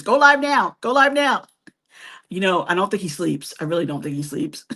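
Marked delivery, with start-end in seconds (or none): 0.0-1.4 higher, urgent mock nagging; a friend nagging her to go live, in a higher mock-urgent voice; imitating Scott's nagging. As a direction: pester her like an impatient friend. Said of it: "Scott's gonna be, like, sending us messages".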